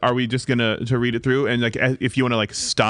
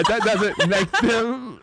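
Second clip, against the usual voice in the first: high, slow words broken by laughter; laughing mid-line; 8 semitones higher, slower than usual. Acted. Actress fumbling her line, breaking into laughter. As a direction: crack up while acting British.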